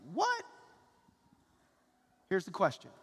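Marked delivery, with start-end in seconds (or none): 0.1-0.4 sudden high "what?"; surprised. Pretend. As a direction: react in disbelief. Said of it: "you're gonna be like".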